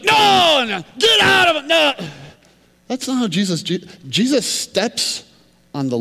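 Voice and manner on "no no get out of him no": high, loud screaming refusal; hammed-up demon yell; mock demon shouting back. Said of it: "And he's like".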